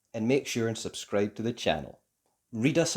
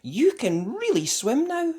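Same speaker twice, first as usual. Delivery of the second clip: praising mother; 12 semitones higher, 4 dB louder than usual. A narrator's reading. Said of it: "said Mummy".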